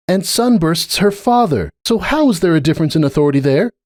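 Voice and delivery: a lightly voiced earnest unicorn pleading her case; Starlight. A narrator's reading. Starlight pressing her point with Cupcake, earnest.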